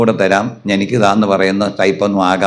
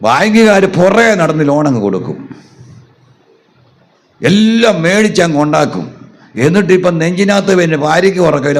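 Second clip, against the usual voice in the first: ordinary delivery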